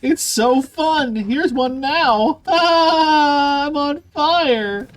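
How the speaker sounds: high, slow mock delight; laughing sarcastic cheer; sarcastic glee. Real, not acted. Sarcastic delight while burning.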